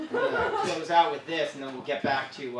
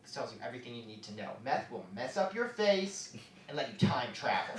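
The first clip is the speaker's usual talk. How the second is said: usual speaking voice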